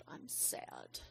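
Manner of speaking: put-on voiceless, hushed child's answer; whispered. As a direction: whisper it like a sad little girl.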